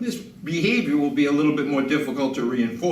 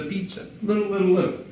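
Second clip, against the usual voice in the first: high, cooing praise for a good dog; sing-song doggy praise; 5 semitones higher, faster than usual. Real, not acted. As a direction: coo little, little to reward him. Praising dog while giving treat.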